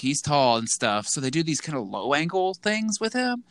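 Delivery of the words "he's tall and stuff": ordinary delivery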